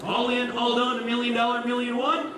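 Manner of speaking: high, rapid auction chant; auctioneer. Acted. Auctioneer calls million bids.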